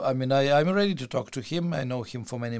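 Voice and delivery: ordinary talk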